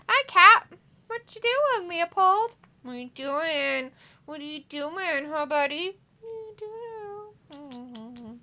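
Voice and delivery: high, sing-song cooing. Real, not acted. Greeting the cat Leopold, high-pitched baby talk. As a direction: greet high, then soften into a drawn-out coo.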